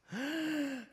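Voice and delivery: sharp high gasp of a panicking mother; startled gasp. Retold or imitated. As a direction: gasp in mock alarm at the child's fall. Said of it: "더 놀라는 엄마들이 있습니다". (깜짝 놀란 엄마의 높은 헉 소리)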